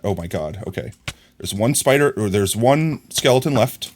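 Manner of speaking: ordinary talk, nothing marked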